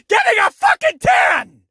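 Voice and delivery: high-pitched comic outburst; exasperated shout. Real, not acted. Reviewer ranting about wrestlers lying there, exasperated shout. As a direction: yell the punchline high, in exasperation.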